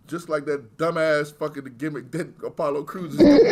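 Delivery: loud, laughing rant. Real, not acted. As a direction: rant through rising laughter. Mocks gimmick while laughing.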